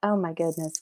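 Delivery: normal talking voice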